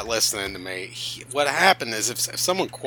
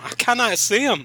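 laughing; 11 semitones higher than usual. Real, not acted. High laughing exclamation.

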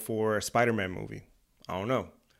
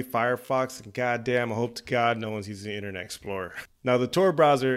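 normal talking voice

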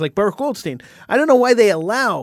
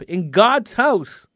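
ordinary delivery

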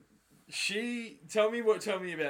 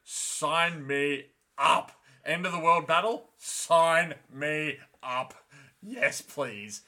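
louder, slow stretched Sign me up; eager; 4 semitones higher, 8 dB louder, slower than usual. Retold or imitated. Egwene thrilled by the powerful women, shouting eagerly. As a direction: relish every word, all in. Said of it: "She's like".

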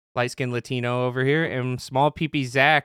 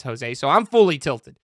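ordinary talk

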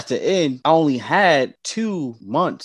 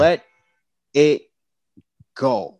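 one word at a time, long pauses; weighty, spaced-out retold advice; slow, deliberate permission; 2 semitones higher, 6 dB louder, slower than usual. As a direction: let each word land separately. Retelling friend's solemn permission.

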